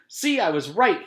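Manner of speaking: high, loud gleeful boast; boy triumphant after being mocked, high and loud. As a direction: crow that you were right. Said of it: "he said".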